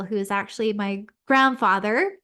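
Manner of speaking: ordinary talk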